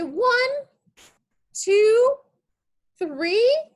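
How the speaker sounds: counting down before the leaves let go; excited countdown. Acted. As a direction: build the count like a launch.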